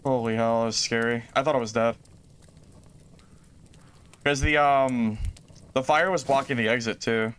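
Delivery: normal talking voice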